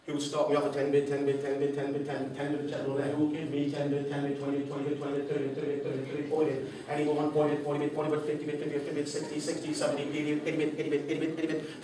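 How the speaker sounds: fast rattling auctioneer call; auctioneer patter. Retold or imitated. Speaker imitates the auctioneer calling bids, rapid patter.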